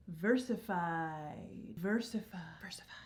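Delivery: title echoed, dying away to a whisper; fading echo. Pretend.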